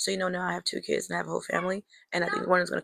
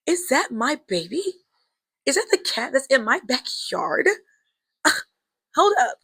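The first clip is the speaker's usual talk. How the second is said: surprised disbelief; 6 semitones higher, 3 dB louder than usual. Retold or imitated. Spotting the lost kitten.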